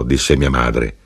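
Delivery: plain reading voice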